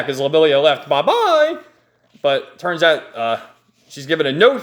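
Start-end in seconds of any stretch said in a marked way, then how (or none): 0.9-1.6 high, singsong mock goodbye; breezy put-on kiss-off, pitched way up; taunting farewell. Villain leaves them stranded.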